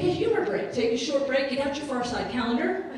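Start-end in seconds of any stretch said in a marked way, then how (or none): none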